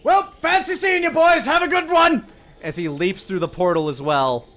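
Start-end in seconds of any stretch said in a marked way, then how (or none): 0.0-2.2 high, loud, cheery farewell yell. Acted. Tom calling to the boys through the portal, yelling. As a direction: holler it from far across, much higher pitched. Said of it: "you hear from the other side Tom yell".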